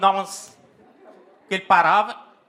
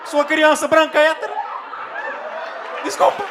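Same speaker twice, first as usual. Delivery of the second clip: frantic pleading; 12 semitones higher than usual. Retold or imitated. Scared kid begging his furious dad, high frantic pleading. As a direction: beg for your life in a squeaky voice.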